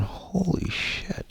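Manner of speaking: high, slow, whispered gasp of amazement; awed whisper. Retold or imitated. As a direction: breathe it out, stunned by the revelation. Sudden revelation of the real self.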